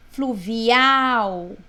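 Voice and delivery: Fluvial with AL loud and drawn out; stress on last syllable. Shown as a demonstration. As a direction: swell hard on the end of Fluvial. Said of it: "última sílaba é forte". (Fluvial com a última sílaba forte e esticada)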